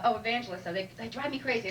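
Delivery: ordinary delivery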